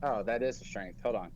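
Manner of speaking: normal talking voice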